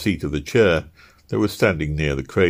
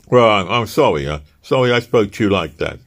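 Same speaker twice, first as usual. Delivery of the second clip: halting, stammering sorry, a bit louder; awkward apology; 3 semitones higher, 5 dB louder than usual. Acted. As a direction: fumble an apology to his crying wife. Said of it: "said Easton awkwardly".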